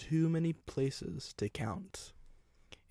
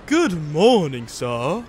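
big, loud, higher-pitched greeting; booming cheerful; 4 semitones higher, 10 dB louder, slower than usual. A narrator's reading. Grand engine greeting a passenger, booming cheerfully. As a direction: boom it out cheerfully. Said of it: "it boomed cheerfully".

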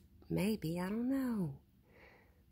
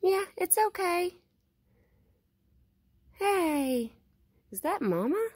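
higher, louder sing-song call to an animal; coaxing pet call; 8 semitones higher, 3 dB louder than usual. Real, not acted. Vlogger calling Mama and hushing the cats, coaxing loud call. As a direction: coax the shy animal closer, calling out.

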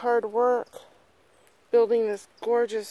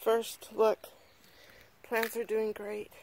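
ordinary delivery

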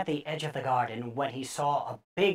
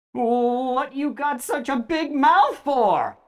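put-on frightened boy; 7 semitones higher, slower than usual. A narrator's reading.

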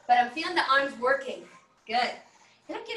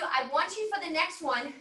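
normal talking voice

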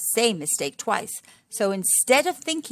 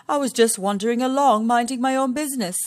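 higher, louder, quick innocent lion; a mildly innocent tale; 4 semitones higher, 6 dB louder than usual. Acted. Lion explaining to Missus Warthog, higher, louder, quick at the end. Lion tells his story.

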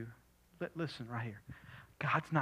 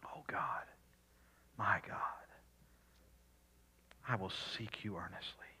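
whispered prayer. Acted. Quiet personal prayer.

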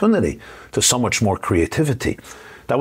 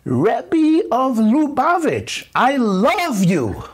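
put-on very high, slow, drawn-out child's cry; mock the little girl calling out with all her heart; little girl screaming; much slower than usual. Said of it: "And she screams out loud".

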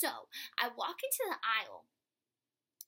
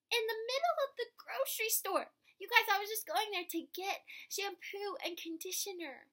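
excited; 7 semitones higher, 5 dB louder than usual. Real, not acted. Excited climax of story.

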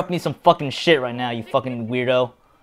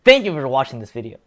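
ordinary talk, nothing marked

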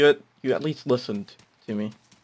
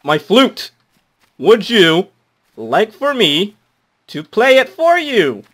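loud slow offer; 6 semitones higher, 8 dB louder, slower than usual. Acted.

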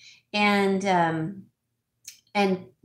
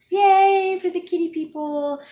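cheering; 10 semitones higher, 6 dB louder than usual. Real, not acted. Host cheers on fellow cat owners, high cheering.

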